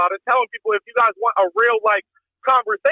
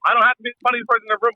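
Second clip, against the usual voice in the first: usual speaking voice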